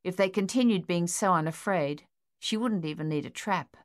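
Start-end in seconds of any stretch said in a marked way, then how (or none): none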